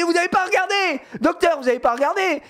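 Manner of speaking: very high, hammed-up indignant cry; playing crazy patient. Dad hamming a furious patient to his daughter, squealing. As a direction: ham up the patient going mad, pitch soaring. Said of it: "je joue le truc de fou".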